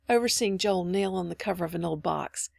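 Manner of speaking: ordinary delivery, nothing marked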